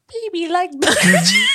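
loud, squeaky mock baby talk; high-pitched toddler voice. Co-host imitating the booger-chair ex, high-pitched toddler voice. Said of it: "very high-pitched voice and spoke like a toddler".

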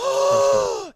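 sharp high gasp of delight. Real, not acted. Hearing a favourite actor was cast, a thrilled gasp. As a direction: gasp like the best news just landed. Excited about casting news.

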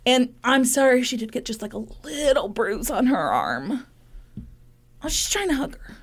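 lightly voiced, high, pleading husband; half-hearted innocent excuses; mock husband voice. Still voicing husband.

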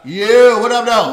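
loud, high hammed-up greeting; boisterous put-on barbershop hello; barber answering phone. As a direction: holler it as a mock barber picking up.

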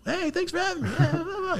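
very high, soft, squeaky greeting; mock talk-show guest. Imagined guest walking out on The Tonight Show, mock falsetto thanks. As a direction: gush thanks in a tiny falsetto.

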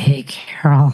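put-on low, creepy old-man voice; pervy old guy. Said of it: "sounded like a total pervy old guy".

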